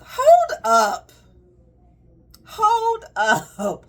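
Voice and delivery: high, loud call to stop; loud hold up. Real, not acted. Mo halting a client's unsolicited advice, loud and high. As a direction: throw up a hand and halt them loudly.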